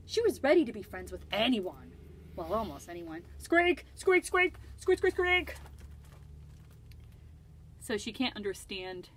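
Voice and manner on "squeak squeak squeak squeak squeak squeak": high, louder squeaky chatter; squeaking. Acted. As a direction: squeak like small creatures. Children heard as squeaks.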